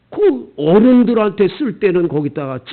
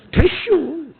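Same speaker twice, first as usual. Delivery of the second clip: put-on Chungcheong accent; 8 semitones higher, much slower than usual. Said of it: "충청도 사람들이요".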